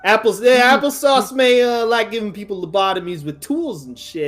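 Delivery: excited New York boxer boasting; Italian-American accent. Acted. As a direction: boxer bragging about his fists in a put-on New York accent. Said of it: "just when I'm talking character".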